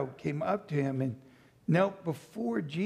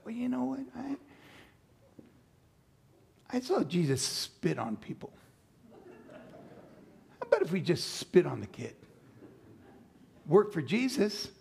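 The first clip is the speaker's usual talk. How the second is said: eager disciple; 5 semitones higher than usual. Pretend. Another disciple suggests spitting.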